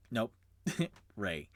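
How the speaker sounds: normal talking voice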